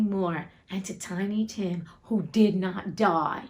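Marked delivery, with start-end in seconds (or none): none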